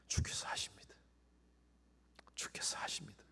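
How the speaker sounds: breathy whispered answer. Acted. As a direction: whisper humbly. Peter's whispered reply. (베드로가 고개 숙여 속삭이는 대답)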